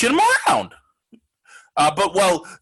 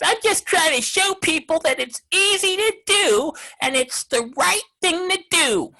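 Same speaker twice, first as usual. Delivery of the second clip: high, put-on voice mocking a player; mocking Frazier's voice; 9 semitones higher than usual. Host mocks player's quote about wearing masks, in a high mocking voice. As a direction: squeak it out like a meek ballplayer. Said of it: "that's how he talks".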